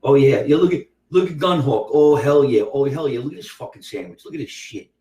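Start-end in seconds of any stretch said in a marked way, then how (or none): 3.2-4.9 hushed, breathless whisper over the food; awed whisper. Real, not acted. Comedian marveling at his sandwich, whispering in awe.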